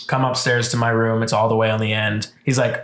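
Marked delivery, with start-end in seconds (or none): none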